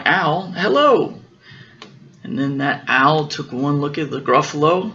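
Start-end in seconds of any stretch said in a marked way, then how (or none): 0.0-1.2 slow, drawn-out little mouse voice; mouse greeting owl. Acted. Mouse slowly greeting the owl, in a lightly voiced mouse voice.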